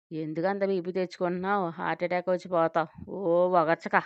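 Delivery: usual speaking voice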